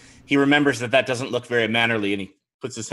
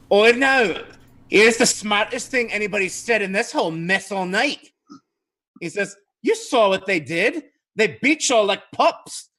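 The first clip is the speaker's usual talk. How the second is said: a scoffing high-pitched madame jeering at the captain; Madame Dimitri's voice; 9 semitones higher than usual. Acted. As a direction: pipe up shrill-high to taunt the knights. Madame Dimitri pipes in mockingly.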